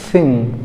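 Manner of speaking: थिंग opening on an airy th, not a stop; native th sound. Shown as a demonstration. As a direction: say थिंग with tongue between teeth, air flowing out. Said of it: "क्या बोलेंगे?".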